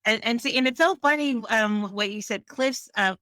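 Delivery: normal talking voice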